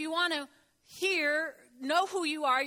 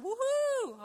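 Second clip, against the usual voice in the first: high, gliding "woohoo"; playful rising cheer; happy whoop; 9 semitones higher than usual. Retold or imitated. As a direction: let out a high, joyful whoop. Cheering after worship music lifts mood.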